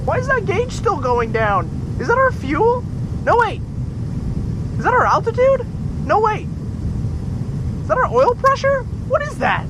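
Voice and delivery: frantic, voice way up in alarm; panicked pilot. Acted.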